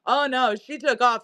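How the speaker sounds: ordinary talk, nothing marked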